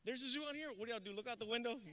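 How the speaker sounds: ordinary talk, nothing marked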